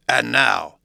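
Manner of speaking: loud sudden opener; loud demand. A narrator's reading. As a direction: bark it out to seize attention. Hyde turns on the lawyer.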